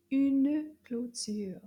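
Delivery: very slow Une clôture; slow French model. Shown as a demonstration. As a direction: spell out each sound slowly.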